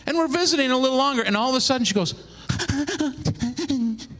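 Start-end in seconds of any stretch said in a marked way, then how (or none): 2.5-3.6 higher strained groan of pain; mock pained groan. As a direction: groan like a contraction hits. Wife's contraction again.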